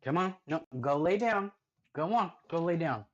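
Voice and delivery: high, loud firm commands; commanding dog. Real, not acted. Owner sending his dog to lie down, loud and firm. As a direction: order her to go lie down.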